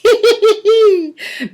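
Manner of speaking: high, far-off giggle like a playful breeze; giggling laugh. Acted. Breezes giggling while spreading the news, high giggle. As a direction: giggle high and light at the gossip. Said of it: "they giggled".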